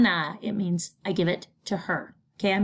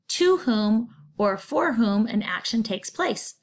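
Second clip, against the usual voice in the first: ordinary delivery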